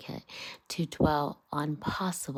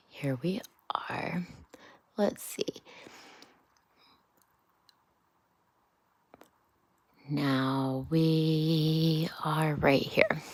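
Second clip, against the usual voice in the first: bedtime murmur to a sleepy son; hushed bedtime whisper; slower than usual. Real, not acted. Whispered bedtime chat before reading.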